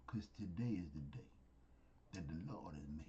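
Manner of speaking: whispered. Shown as a demonstration. Preacher repeats the verse to listeners, whispering. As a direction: let the verse drop to a hushed whisper.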